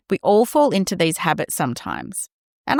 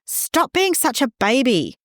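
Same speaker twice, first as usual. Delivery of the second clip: raised-pitch mock snap; tired parent name calling, mock snap; snapping parent; 6 semitones higher than usual. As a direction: snap at the kids, exasperated. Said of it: "we snap".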